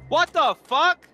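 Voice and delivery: loud, slow startled yell; startled shout. Real, not acted. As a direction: yell out in shock. Cat jumps on desk.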